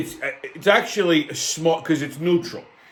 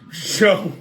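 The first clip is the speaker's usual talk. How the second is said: usual speaking voice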